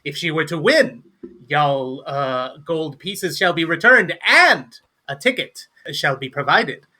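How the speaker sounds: theatrical host with high, punched key words; showy gnome barkeep. Acted. Gnome setting drinking contest terms for the party, showy flourish. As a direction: swoop through the terms grandly.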